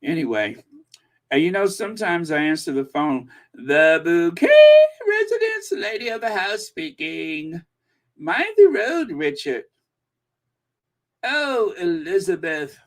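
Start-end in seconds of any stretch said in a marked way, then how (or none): 3.5-7.6 grand singsong telephone greeting, soaring high; hammed-up posh hostess, pitch leaping up; Hyacinth Bucket. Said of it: "Every so often I start to channel". 8.2-12.8 high, loud posh fussing that drops away; hammed-up posh lady, swooping pitch; Hyacinth Bucket. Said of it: "Every so often I start to channel".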